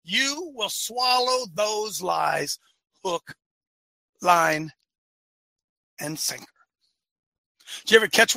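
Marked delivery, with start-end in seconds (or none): 0.0-6.5 much softer, lower and slow; dropped to a hush. Acted. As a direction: let each word sink like a weight. Dramatic drop after shouting.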